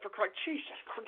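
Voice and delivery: ordinary talk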